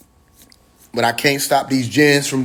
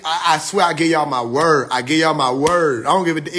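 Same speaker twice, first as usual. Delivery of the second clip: ordinary talk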